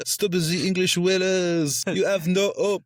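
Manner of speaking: put-on high-pitched yelling in a French accent; clichéd French accent. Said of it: "incredibly cliched French accent yelling out".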